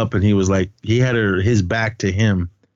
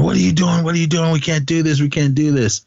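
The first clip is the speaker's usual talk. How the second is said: higher-pitched, repeated pleading; mock panicked protest; 8 semitones higher, faster than usual. Retelling a friend's protest at the taping, mock panicked. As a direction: imitate someone alarmed, pitched well up.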